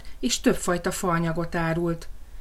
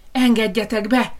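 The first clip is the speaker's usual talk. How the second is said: louder, higher stamping shout; shouted demand; 4 semitones higher, 6 dB louder than usual. Acted. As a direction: yell at the door. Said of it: "kiabálta toporzékolva a farkas". (toporzékolva kiabáló farkas)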